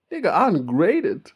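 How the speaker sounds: hammed-up outrage at an ungraded mark; mock shock. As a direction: play it as scandalised disbelief. Acting shocked at ungraded result.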